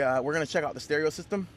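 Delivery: ordinary delivery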